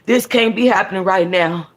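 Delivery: ordinary delivery, nothing marked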